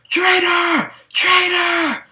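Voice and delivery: high-pitched mock scream of traitor; imitated screaming. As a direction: shout it like a furious villain. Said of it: "he just starts screaming".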